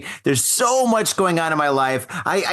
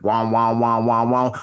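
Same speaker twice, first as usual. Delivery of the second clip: slow-motion sound; 6 semitones lower than usual. Retold or imitated. Retelling the shoe throw, a slow-motion wow. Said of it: "it was, like, in slow motion".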